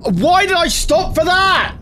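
high-pitched rage, slowing on the last words; real frustrated outburst. Real, not acted. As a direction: yell at yourself in real annoyance. Raging at own mistake.

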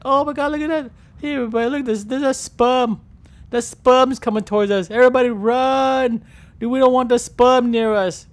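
high, loud mock alarm; baby whale crying out a warning to the pod; panicked baby whales. Baby whales warning each other about the sperm, mock panic. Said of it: "all the baby whales be like".

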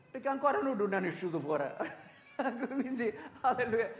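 real amused laugh breaking into the talk. Real, not acted. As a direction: chuckle through the aside. Preacher laughs at his own quip.

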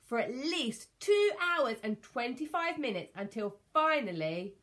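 usual speaking voice

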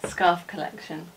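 ordinary delivery

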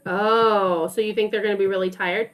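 ordinary talk

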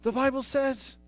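climbing from firm to loud preaching; building sermon. Acted. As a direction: build the passion line by line. Preaching rising to full force.

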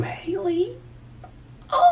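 tiny, soft, very high questioning voice; high falsetto squeal. Pretend. Reacting to a fan's compliment, soft falsetto squeal. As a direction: squeak the name softly in falsetto.

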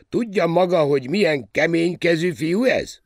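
very high, loud captain's voice; loud, worked-up question; emphatic exclamation. A narrator's reading. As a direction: exclaim it loudly at a high pitch.

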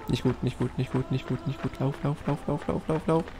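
hushed frantic muttering while fleeing; being chased. Real, not acted. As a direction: mutter it quietly in a rush. Fleeing back to base.

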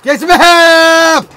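high, slow, loud mock shout. Real, not acted. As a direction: bellow it at the screen. Yelling at game character.

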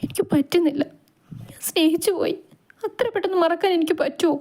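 high-pitched, broken crying voice; tearful crying. A narrator's reading. Vaidu confesses she cannot forget her love, acted tearful crying. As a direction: plead as if choked with tears. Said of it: "എന്തിനാ വൈദു ഇങ്ങനെ കരയുന്നത്".

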